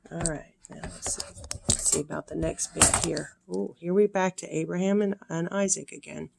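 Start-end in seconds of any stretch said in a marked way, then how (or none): none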